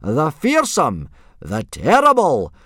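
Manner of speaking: high, loud, drawn-out billing; forced-enthusiasm yell. A narrator's reading. Instructor introducing boy and dragon, yelling with forced enthusiasm. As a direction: stretch each grand title as you yell. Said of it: "trying to put a bit of enthusiasm into his yelling".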